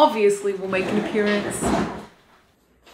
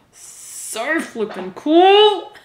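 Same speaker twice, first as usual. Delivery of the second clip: high, loud, drawn-out gush; giddy excitement, very slow and big; thrilled; 8 semitones higher, 6 dB louder, much slower than usual. Real, not acted. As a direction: stretch it out in delight, breaking into a laugh. Thrilled by the blanket's colours.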